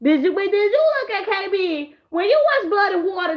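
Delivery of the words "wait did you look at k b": usual speaking voice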